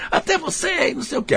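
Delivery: mock outburst in a very high, thin-volume voice; imitando bronca. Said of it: "Aí ele virou o demônio". (bronca imitada, bem aguda e baixinha)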